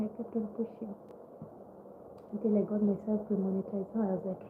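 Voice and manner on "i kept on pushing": whispered, much softer; fading whisper. Real, not acted. YouTuber recalling her persistence, trailing into a whisper. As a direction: let it fade to a whisper.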